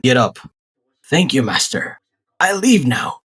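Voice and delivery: normal talking voice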